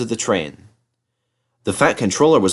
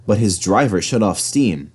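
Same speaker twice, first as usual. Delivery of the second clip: plain reading voice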